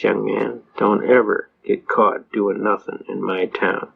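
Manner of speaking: low, slow judge's warning; imitated stern warning. Judge advising a young man, low mock-stern warning. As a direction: drop the pitch and spell out the warning. Judge warns the teller in acted quote.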